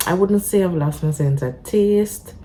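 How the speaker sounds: ordinary talk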